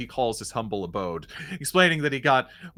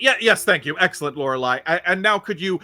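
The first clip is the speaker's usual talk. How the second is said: hearty showman; 4 semitones higher, 7 dB louder, faster than usual. Acted. Magician thanks Lorelei.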